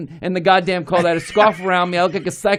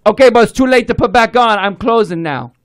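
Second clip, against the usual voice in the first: Chinese accent impression; 2 semitones higher, 4 dB louder than usual. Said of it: "he goes".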